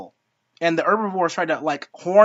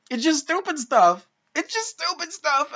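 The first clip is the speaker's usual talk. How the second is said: exasperated; 9 semitones higher than usual. Real, not acted. Worked-up rant about writing.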